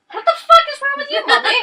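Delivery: very high, loud put-on voice; mocking the rude customer in a very high voice; mocking squeaky voice. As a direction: squeak it like a cartoon. Mocking voice aside.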